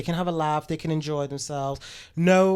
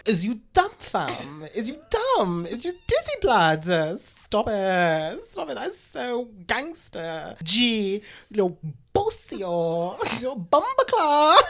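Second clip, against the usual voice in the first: higher camp London roadman voice; london roadman accent; 5 semitones higher, slower than usual. Pretend. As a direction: ham up a campy roadman. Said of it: "I want more".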